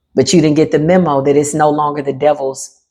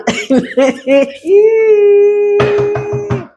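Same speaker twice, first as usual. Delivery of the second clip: high mocking laugh. Real, not acted. Laughing at the crying enemy.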